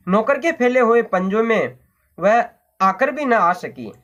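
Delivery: usual speaking voice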